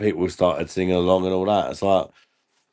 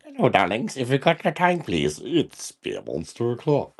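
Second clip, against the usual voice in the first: lightly hammed-up show intro, pitch jumping high; old intro catchphrase; 4 semitones higher than usual. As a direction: greet the audience in a playful put-on intro voice. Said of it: "it used to go".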